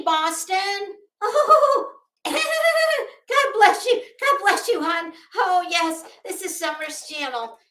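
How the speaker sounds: hammed-up livestreamer parody; P G imitation. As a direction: gush blessings, then curse. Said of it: "doing a P G imitation".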